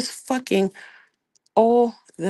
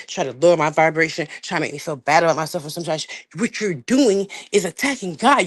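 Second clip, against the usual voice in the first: normal talking voice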